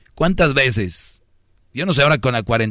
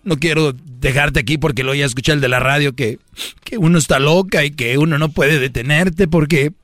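put-on passive-aggressive girlfriend; 2 semitones higher than usual.

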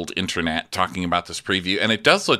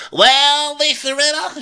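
high, loud mock Erica; hammed-up Erica; imitating Erica; 5 dB louder, slower than usual. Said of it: "Erica's saying really sage things like".

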